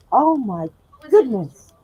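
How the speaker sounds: ordinary delivery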